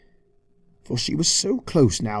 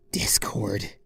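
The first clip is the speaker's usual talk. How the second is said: a soft, urgent whispered hiss; 4 semitones lower, 6 dB quieter than usual. Acted. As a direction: hiss the name so nobody else hears. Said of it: "Twilight hissed".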